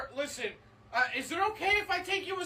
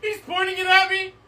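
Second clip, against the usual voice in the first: much louder, frantic call; panicked plea; 4 semitones higher, 9 dB louder than usual. Acted. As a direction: yell for backup in panic. Panicked radio call for help.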